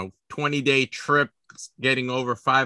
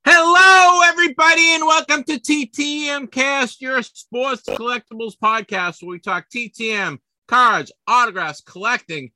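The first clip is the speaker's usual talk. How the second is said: announcer show opening; 8 semitones higher, 7 dB louder than usual. Real, not acted. Host greets listeners at the podcast's start, big announcer shout. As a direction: belt out the show welcome like an announcer.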